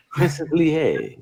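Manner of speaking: "Lie" drawn out slowly as lee-AY; fancy pronunciation. Pretend. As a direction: stretch out "Princess Lie" as a fancy name. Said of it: "Lie sounds so fancy".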